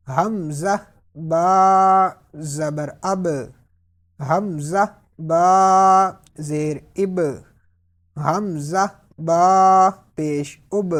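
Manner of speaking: اب ending with a bounced, echoing با; slow, loud recitation drill; qalqalah echo. Shown as a demonstration. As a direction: let the با in اب bounce at the end. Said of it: "قلقلہ کی آواز آپ اینڈ پہ نکالیں گے".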